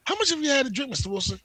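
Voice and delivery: mock cop voice.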